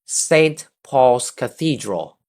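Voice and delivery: "Saint Paul's Cathedral" slow, stress on "Saint", falling at the end; slow teacher model phrase; model for repeating. Shown as a demonstration. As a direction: say it slowly for the class to echo. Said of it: "read after me".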